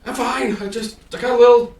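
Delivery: put-on high, loud squeaky old man brushing it off; squeaky and sheepish, pitched high; old halfling embarrassed about crashing his cart, squeaky voice; flustered old halfling.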